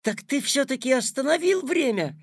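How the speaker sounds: put-on high, delighted boyish question; catching on with a smile; smiling realisation. A narrator's reading. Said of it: "тоже улыбнулся Петя".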